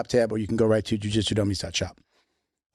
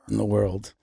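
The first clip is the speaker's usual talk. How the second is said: lower, voiced and restrained; mock-dramatic low voice; 4 semitones lower, 3 dB quieter than usual. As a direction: drop the pitch for a grand boast. Same boast repeated, now voiced.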